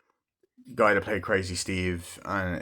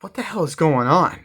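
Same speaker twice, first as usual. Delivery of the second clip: loud, higher bewildered shout; confused outburst; 7 semitones higher, 6 dB louder than usual. Retold or imitated. Teenagers yelling at the odd old woman, loud and confused. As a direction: demand to know what's happening.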